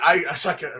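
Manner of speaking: normal talking voice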